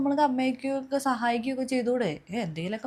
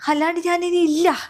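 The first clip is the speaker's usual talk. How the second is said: higher and louder, final; angry ultimatum; 6 semitones higher, 7 dB louder than usual. Acted. Fed-up wife telling her husband she's not coming back, angrily. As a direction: slam the door on it with your voice.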